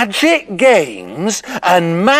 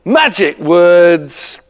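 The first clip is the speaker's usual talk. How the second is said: ordinary delivery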